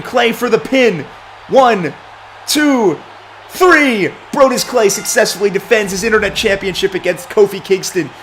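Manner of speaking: high, loud ringside call building to the three count; excited play-by-play. Acted. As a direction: call the pin like the crowd is on its feet. Live wrestling play-by-play call.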